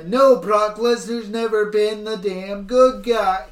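loud, exasperated outburst; escalating comedy rant. Real, not acted. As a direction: blow up at the booking. Ranting against the storyline.

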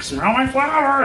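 giggly sinister sing-song; high, creepy clown squeal; scary clown voice. Retold or imitated. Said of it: "Why are you doing a scary clown impression".

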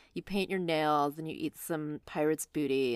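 usual speaking voice

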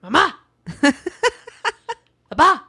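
short, high demand in imitation of a toddler; authoritative toddler. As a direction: mimic a little kid giving orders. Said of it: "It's more like".

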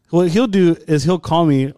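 ordinary talk, nothing marked

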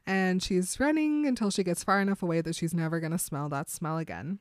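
normal talking voice

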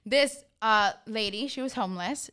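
ordinary talk, nothing marked

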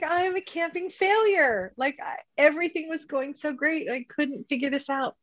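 high, dramatic self-pity; mock despair. Retelling her woe to friend.

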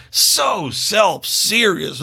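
very slow, stretched, self-serious parody; mock drawn-out delivery. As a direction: drag every word out mockingly. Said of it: "draw it out".

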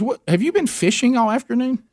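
the wife accusing him of fishing all day; imitating wife. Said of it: "She goes".

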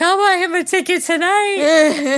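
high, loud, drawn-out re-enacted excitement; eager outburst. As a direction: blurt it out high and stretched, hammed-up. Acts out her own eager reply.

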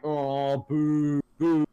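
mock crowd boo, drawn out. Fan retelling the crowd's reaction to the pin, imitated boos. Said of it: "or the crowd's like".

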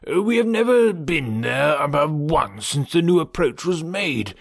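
put-on anxious, fussing old father; fussy Mister Woodhouse. Father giving Emma instructions for declining and the carriage, gently fussy. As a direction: fuss over every little arrangement in a lilting voice.

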